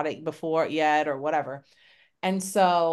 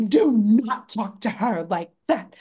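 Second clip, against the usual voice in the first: loud, slow, higher mock scolding; scandalized women's reproach, each word weighted; aghast; 4 semitones higher, 6 dB louder than usual. Women defending her from a swearing man, aghast scolding. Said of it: "like, aghast".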